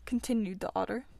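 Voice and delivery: usual speaking voice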